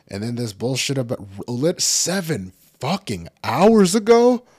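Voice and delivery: rising into an outraged yell; outraged shout. Real, not acted. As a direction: voice shooting very high and loud on ago. Outraged at seven hours delay.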